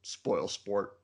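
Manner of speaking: low, quiet grumble; muttered. Retold or imitated. Retelling the book's chapter ending, muttered. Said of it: "he just mutters".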